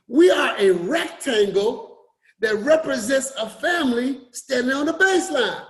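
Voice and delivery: usual speaking voice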